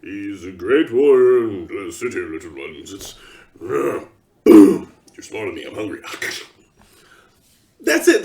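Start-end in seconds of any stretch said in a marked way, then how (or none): 0.0-6.0 deep, blunt orc grunt with a sudden loud boom; brutish orc. Retold or imitated. Mocking how orcs would really talk, deep grunting orc. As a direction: talk like a simple, hungry orc. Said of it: "they don't".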